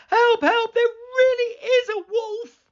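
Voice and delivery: high, loud, teary cry for help; high, loud tearful alarm; frightened crying shout. Acted. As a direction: beg them in panic. Boy in real panic.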